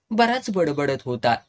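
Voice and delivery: usual speaking voice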